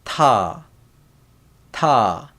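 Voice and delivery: ta, with a puff after the T; T sound demo. Shown as a demonstration. Teacher showing the T sound, demonstrating "ta" distinctly. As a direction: say "ta" as a separate model syllable, falling. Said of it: "T sound".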